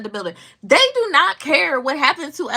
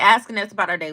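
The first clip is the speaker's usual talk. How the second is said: ordinary talk, nothing marked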